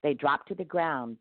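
usual speaking voice

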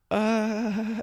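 high drawn-out noncommittal uh; evasive hesitant uh. Retold or imitated. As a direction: dodge the question with a vague uh. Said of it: "the response is always".

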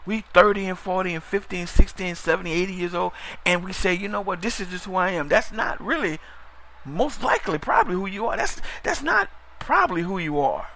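ordinary delivery

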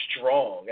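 Strong set apart and punched; stress on Strong. Shown as a demonstration. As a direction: lean hard on the word "Strong". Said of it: "I emphasized the word strong".

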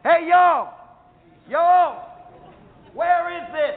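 loud, high call over the ship's side. Retold or imitated. Chris leaning over the ship's side calling to the beach, loud shout. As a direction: holler to the people on the beach. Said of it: "leans over the side of the ship".

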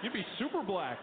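put-on slow, drawn-out American accent; hearty American buddy assuring him. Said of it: "He was like".

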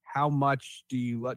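ordinary talk, nothing marked

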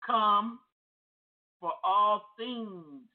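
slow deliberate phrase, dwell on all; slow emphatic call. Shown as a demonstration. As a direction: draw it out word by word. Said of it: "slowed repeat".

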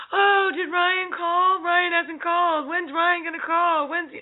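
high-pitched, drawn-out fretting; hammed-up needy worrier, high and slow; anxious scarcity mindset. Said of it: "you come from a place of scarcity".

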